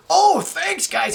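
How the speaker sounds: high, cheery thanks; lightly voiced friendly celebrity; actor thanking fans. Actor Sam thanking two fans, lightly imitated cheery. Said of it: "And he's like".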